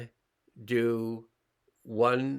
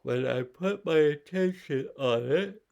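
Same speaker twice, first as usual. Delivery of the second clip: yawning; 5 semitones higher, 4 dB louder than usual. Real, not acted. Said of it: "my body wants to yawn".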